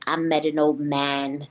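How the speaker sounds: low, slow, eerie imitation; weird Drusilla. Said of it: "you know, she's so weird".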